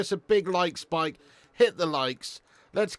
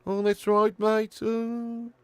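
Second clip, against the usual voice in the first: put-on Brummie accent, slightly raised pitch; Brummie Jackie Grealish impression; 3 semitones higher than usual. Streamer proving a footballer is English, mock Brummie accent. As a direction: ham up a Birmingham accent, stretching the last sound. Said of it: "he's fucking Brummie ass".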